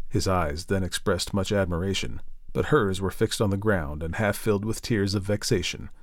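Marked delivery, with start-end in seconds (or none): none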